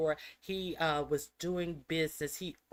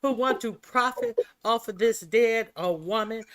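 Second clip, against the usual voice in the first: loud, high heated rant; angry; 5 semitones higher, 13 dB louder than usual. Real, not acted. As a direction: rant with rising fury.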